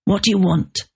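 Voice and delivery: hoarse, unfriendly answer; hoarse and hostile. A narrator's reading. Victor answers an unwelcome call without clearing his throat, hoarse and hostile. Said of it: "Victor's voice was hoarse and hostile".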